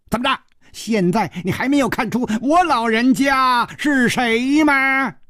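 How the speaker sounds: high, boastful character voice; insistent old dwarf. A narrator's reading. Dwarf pressing Hu Tiehua to recognise him, boastful.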